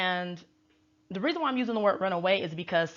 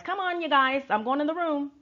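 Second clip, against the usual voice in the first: light mock accent of no clear origin, pitched up; hint of an unplaceable accent, playfully imitated; [name]; 7 semitones higher than usual. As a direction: put on a muddled, higher-voiced accent. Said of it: "I don't even know what accent that was".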